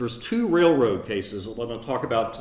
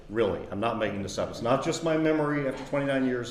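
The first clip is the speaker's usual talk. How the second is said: normal talking voice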